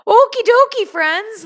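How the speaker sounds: greeting friends with a loud okie dokie. Real, not acted. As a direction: burst out with it. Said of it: "That was a loud okie dokie".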